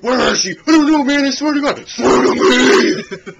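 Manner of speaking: high, very loud mock roar; angry, over-intense. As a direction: overblown movie-hero rage. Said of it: "angry and, like, over intense".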